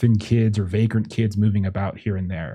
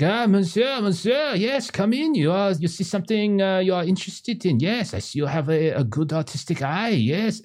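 put-on high, eager French accent; French merchant; 10 semitones higher than usual. Eccentric French artist welcoming a visitor into his tent, high eager French accent. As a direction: fawn over the customer in a thick French accent. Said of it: "And all this is in French".

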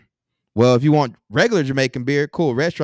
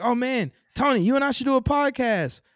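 much higher, excited imitation of a fan; eager guests; 10 semitones higher than usual. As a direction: chirp it up high like an overeager guest. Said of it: "they're like".

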